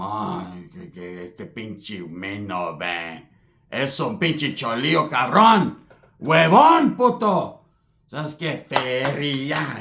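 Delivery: low, slow muttering uncle in Spanish; a hint of grumpy uncle. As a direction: grumble like a resentful uncle. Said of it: "my tío Angel was mad".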